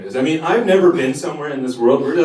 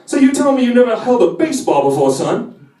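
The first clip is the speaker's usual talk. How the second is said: Foghorn voice; 8 semitones higher than usual. Retold or imitated.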